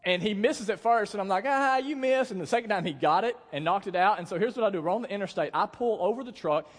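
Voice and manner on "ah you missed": higher-pitched retold jeer; playful taunt. Driver to buddy who missed the Snowball, retold taunt. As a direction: crow it like a teasing buddy.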